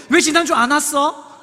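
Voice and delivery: high, loud interrogation; scolding. Acted. Woman scolding a young man for missing church, high and loud. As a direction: demand an answer in a loud mock scolding. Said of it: "맨날 혼내고".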